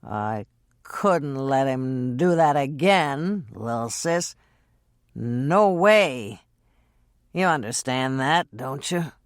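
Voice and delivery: slow, low, slurred words; low slurred drunk voice; slurring. Acted.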